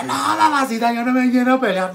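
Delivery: put-on high, slow begging kid; pleading child. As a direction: beg mom not to make him fight. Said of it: "Y yo".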